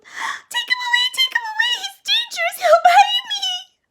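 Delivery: very high, loud, crying squeals; panicked squeal. A narrator's reading. Frightened girl squealing at the approaching dog, panicked. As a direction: wail in terror at the dog.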